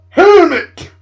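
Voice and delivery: very high, full-blast screech; yelled insult. Acted. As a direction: screech the insult at the top of your voice.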